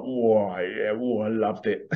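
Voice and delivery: lightly voiced pirate; relishing it like an old sea rogue; a hint of pirate swagger; pirate character. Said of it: "like this pirate character".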